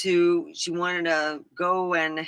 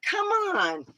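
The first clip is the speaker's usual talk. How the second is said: higher, whiny complaint; teen begging to stay at the kids' table; whiny protest; 14 semitones higher than usual. Retold or imitated. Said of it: "I'm like".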